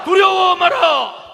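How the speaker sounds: slow, high, shouted slogan; roaring rallying speech. Real, not acted. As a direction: bellow the chant for the crowd to echo. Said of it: "우리의 구호를 함께하겠습니다".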